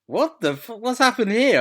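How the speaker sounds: sudden high, loud disbelief; shocked. Retold or imitated. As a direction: blurt it out, startled. Retelling a shocked wake-up reaction.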